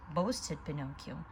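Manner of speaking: plain reading voice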